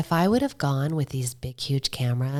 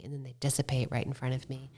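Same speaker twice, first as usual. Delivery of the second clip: ordinary delivery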